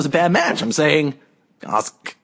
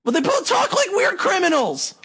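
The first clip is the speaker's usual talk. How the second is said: high-pitched, defensive comeback; exasperated insistence; 14 semitones higher than usual. Real, not acted. Insisting after being contradicted.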